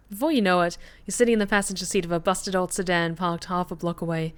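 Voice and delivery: ordinary talk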